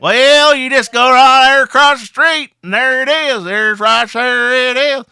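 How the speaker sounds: loud, high Southern twang; southern accent. Acted. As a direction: holler directions in a broad Southern accent. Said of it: "speak in a southern accent".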